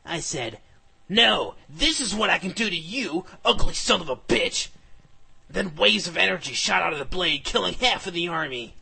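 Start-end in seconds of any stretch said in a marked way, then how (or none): 1.1-4.7 loud, higher battle cry; angry retort. Acted. Hero answering the villain's taunt with an attack, angry retort. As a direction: hurl the insult with force.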